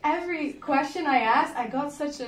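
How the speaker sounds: ordinary delivery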